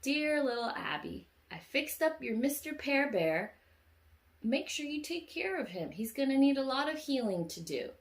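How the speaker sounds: high, sing-out storybook voice; Miss Santa Claus. Retold or imitated. Letter to little Abby about her mended bear, kindly character voice. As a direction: read a letter aloud to a little child. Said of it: "And Miss Santa Claus said".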